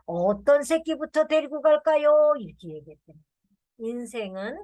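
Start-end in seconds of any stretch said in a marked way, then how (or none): 0.0-2.3 put-on higher, louder guileless kid voice; innocent grandson. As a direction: ask it brightly like a polite child. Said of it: "손자가 뭐라 그랬는지".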